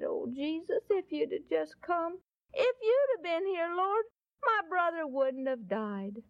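a touch of grief in a pleading retelling; gently acted sorrowful plea; mildly tearful Mary. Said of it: "there were tears rolling down Mary's face".

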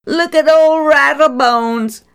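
high, loud jeering call; mocking shout. Schoolboy jeers at an old man in the street, loud mocking shout. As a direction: bellow it to mock the old man.

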